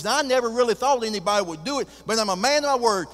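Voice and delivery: high, loud and fast, full of bluster; a cowboy tycoon honoring his word; booming rancher making good on a bet; proud billionaire. Acted. Billionaire keeps his promise.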